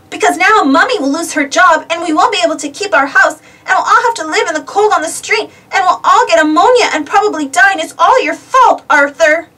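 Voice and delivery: high run-on melodramatic rant; panicked tirade. A narrator's reading. Sister blames her brother for the coming ruin, ranting in panic. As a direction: build a hammed-up wail into blame.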